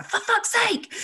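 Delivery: high, sharp exasperated outburst; mock-angry for fuck's sake; exasperated snap. Shown as a demonstration. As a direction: snap it as if fed up.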